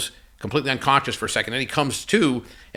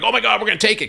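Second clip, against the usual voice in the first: high-pitched, eager retold outburst; imitating thrilled investors; excited investors; 10 semitones higher, 4 dB louder, faster than usual. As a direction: jump at the offer, voice leaping up. Investors jumping at an offer.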